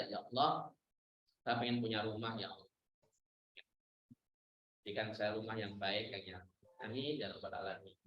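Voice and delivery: low, soft, trusting request; calm humble prayer. Retold or imitated. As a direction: pray it low and quiet, full of trust. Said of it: "Minta saja". (doa tenang, suara rendah dan sangat lirih)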